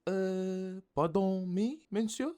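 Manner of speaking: put-on high, polite French-accented query; puzzled but kind, pitched well up; kindly French baker. A narrator's reading. Baker puzzled by a copying customer, high kindly French voice. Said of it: "she said kindly".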